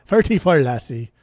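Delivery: joking Scottish or Irish lilt; Scottish or Irish accent. Pretend. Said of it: "With my Scottish or Irish accent".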